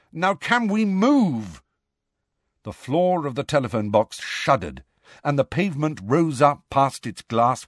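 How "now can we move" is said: high, drawn-out impatient demand; loud impatience. A narrator's reading. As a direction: demand slowly, with pitch raised high. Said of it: "Harry said loudly".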